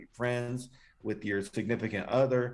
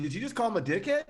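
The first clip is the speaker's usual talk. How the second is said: surprised; 8 semitones higher, faster than usual. Real, not acted. Co-host reacts to the guest calling someone a dickhead, surprised. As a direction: pitch jumps up, quick and incredulous.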